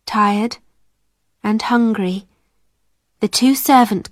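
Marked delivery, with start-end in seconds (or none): none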